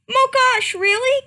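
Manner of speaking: high, loud excited squeal; squealing excitement. Acted. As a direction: squeal with delight. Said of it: "creamed Starpaw".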